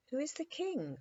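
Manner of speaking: put-on high, curious girl's question. A narrator's reading. Dimples asks the captain about the king, curious and high. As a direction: ask it wide-eyed, like a little girl. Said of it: "asked Dimples curiously".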